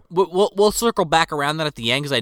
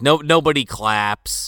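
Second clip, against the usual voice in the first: normal talking voice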